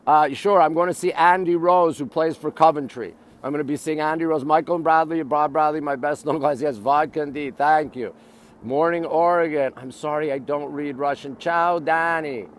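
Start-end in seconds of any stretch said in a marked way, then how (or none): none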